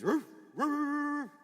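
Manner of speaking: high, loud hound barks; hammed-up hound baying; dog bark imitation. Imitating the hound barking at night.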